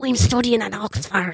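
mock Dublin accent; Barry Keoghan. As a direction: imitate a Dubliner passing himself off as English. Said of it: "he just has a Dublin accent".

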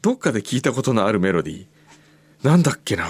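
ordinary talk, nothing marked

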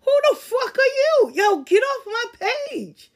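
high, loud laughing squawk; incredulous laughing yell; mock outrage. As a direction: play the offended stranger, cracking up. Mock outraged reaction to page police.

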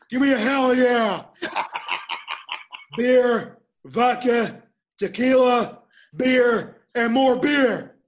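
loud wrestler promo shout; Stone Cold. Acted. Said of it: "'cause Stone Cold said so".

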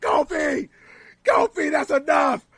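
high, loud warning shout; mock shout. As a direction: call across the ring, stop him. Lashley shouting at Kofi.

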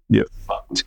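hushed, gloating "you fucked" under the breath; savoring a win over the school; gloating whisper. Retold or imitated. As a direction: whisper it in private triumph. Gloating to himself when found.

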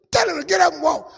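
God losing patience, bark it; a stern, commanding God; angry command. Retold or imitated. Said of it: "it's like God was angry".

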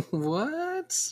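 laughing, sharply rising "What?" pitched high; a startled, amused disbelief; surprised what. Real, not acted. Co-host reacting to the odd drink, laughing surprised.

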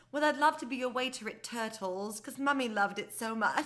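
mock British accent. Child telling dad a career dream, in mock British accent. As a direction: play a little kid putting on British English. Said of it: "why are you talking in a British accent".

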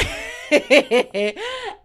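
loud burst of laughter; real laugh. Real, not acted. Laughing at her own sassy comeback. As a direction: break up laughing at herself.

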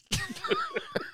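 kurzes, quietschend hohes Lachen; high squealing laugh. Real, not acted. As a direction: lacht plötzlich hoch und gepresst auf. Laughing at his own joke.

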